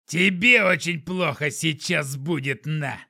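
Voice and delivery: slow gruff threat; growling thug. A narrator's reading. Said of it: "зарычал он".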